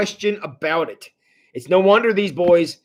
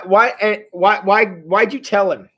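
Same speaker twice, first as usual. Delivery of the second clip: ordinary talk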